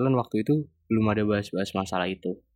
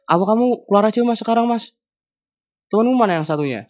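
imitated exasperated scolding; 4 semitones higher, 3 dB louder than usual. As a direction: act out a lecturer getting worked up. Lecturer scolds student, building.

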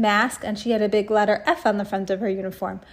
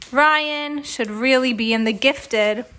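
ordinary delivery, nothing marked

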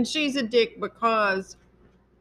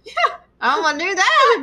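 excited; 9 semitones higher, 8 dB louder than usual. Real, not acted. Excited agreement, laughing.

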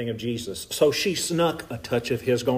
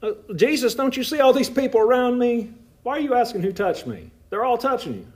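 higher, mocking know-it-all voice; smart-alecky disciples; 9 semitones higher than usual. Preacher voicing sarcastic disciples to Jesus, higher mock tone. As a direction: needle him like a smart aleck. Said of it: "What do they say to him".

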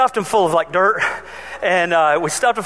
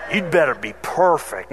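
low stern parent; 7 semitones lower than usual. Retold or imitated. Repeating the parents' threat.

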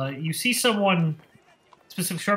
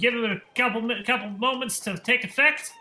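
higher, louder huckster pitching his tonic; snake-oil salesman; 6 semitones higher, 3 dB louder than usual. Acted. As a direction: sell it like a carnival quack hawking a cure. Salesman addresses the audience.

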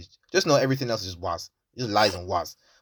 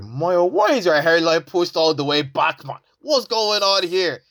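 1 teasing classmate; 6 semitones higher, 4 dB louder, slower than usual. Retold or imitated. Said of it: "Even the wife was like".

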